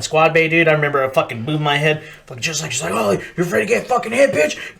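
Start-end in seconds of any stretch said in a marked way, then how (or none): 2.9-4.8 high mock-sergeant sneer; imitated high-pitched bullying; taunting drill instructor. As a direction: taunt like an instructor picking on a recruit.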